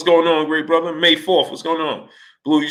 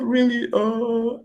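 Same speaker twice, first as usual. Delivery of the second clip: higher, drawn-out hesitant voice; Manny impression; 9 semitones higher, 4 dB quieter, slower than usual. Boxer stalling before answering a question, Manny impression. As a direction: stall in an amateur impersonator's higher voice. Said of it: "Manny, what about".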